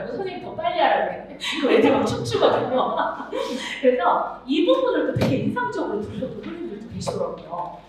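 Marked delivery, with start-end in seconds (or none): none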